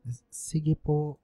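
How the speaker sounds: soft, slow, cowed reply; small frightened stammer; meek stammer. Acted. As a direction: son quietly giving in to his angry mother. Son cowed after scolding.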